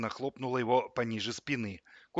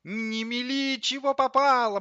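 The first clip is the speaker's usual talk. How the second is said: very high, ringing rebuke; scolding microwave; 13 semitones higher, 5 dB louder than usual. A narrator's reading. Microwave scolds the coffee grinder, high sharp voice. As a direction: cut the chatter off, high-pitched.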